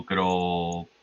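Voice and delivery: ordinary talk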